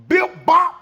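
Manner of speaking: high loud bip bop; comic punching noises, loud and high; punch sound effects. Retold or imitated. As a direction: pop out two quick punches.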